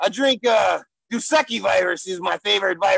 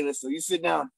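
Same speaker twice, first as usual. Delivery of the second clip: ordinary delivery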